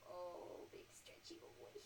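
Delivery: soft breathy whisper; whisper to dog. Real, not acted. Cooing to her dog in a whisper. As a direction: whisper fondly to a sleepy pet.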